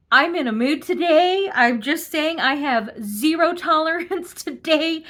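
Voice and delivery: sharp and amused, spoiling for a fight; in a mood. Real, not acted. Host tells viewers she has zero tolerance, laughing snark. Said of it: "really snarky me today".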